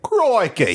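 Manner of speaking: "crikey" said "croykey", Australian vowel, with a laugh. Shown as a demonstration. Showing his cohost the Australian way, laughing. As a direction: drawl the "crikey" with a broad Australian "oy". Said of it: "The way they say it".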